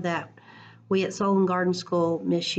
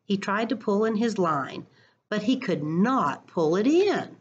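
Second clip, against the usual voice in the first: expressive picture-book read-aloud; reading to children; 3 semitones higher, slower than usual. Acted. Teacher reading a picture book to her class, lively storytime voice.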